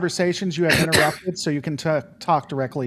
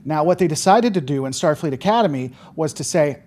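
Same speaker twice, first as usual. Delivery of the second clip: normal talking voice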